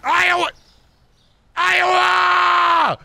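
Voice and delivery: loud high yell; shouting. Acted. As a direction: yell it across the room. Yelling a name out.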